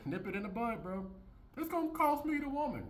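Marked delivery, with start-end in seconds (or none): 1.6-2.8 high whiny mock voice; mock whine of a man afraid to lose her; mocking simp. Mocking a simp's worry.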